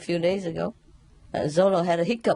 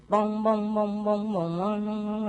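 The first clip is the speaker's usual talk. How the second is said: imitated wooden fish knocks; slower than usual. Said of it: "They beat the wooden fish every day".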